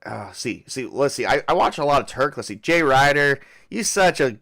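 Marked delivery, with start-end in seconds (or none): 2.6-4.4 put-on half-hearted country accent, loud; country accent attempt. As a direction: try a loud country twang, then give up. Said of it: "Can I get a country accent going?".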